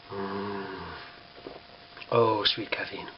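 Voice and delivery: low, soft, slow sigh of bliss; low hushed murmur of contentment; blissful. Real, not acted. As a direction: croon lovingly to your coffee. Savouring beloved caffeine.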